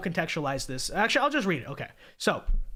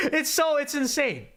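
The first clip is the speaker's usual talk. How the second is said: laughing; 9 semitones higher than usual. Real, not acted.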